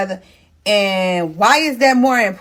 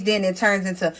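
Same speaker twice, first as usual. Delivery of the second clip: normal talking voice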